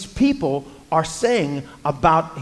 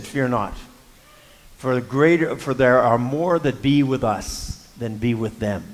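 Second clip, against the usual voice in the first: calm reassurance; 5 semitones lower than usual. Retold or imitated. Elisha reassures his frightened servant.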